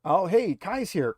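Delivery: usual speaking voice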